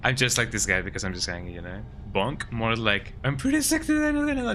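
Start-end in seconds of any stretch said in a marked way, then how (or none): 3.2-4.5 high mock voice. Mocking a viewer's reply.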